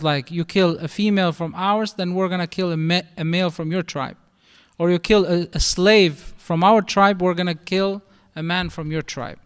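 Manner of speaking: ordinary delivery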